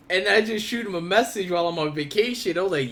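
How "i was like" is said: ordinary talk, nothing marked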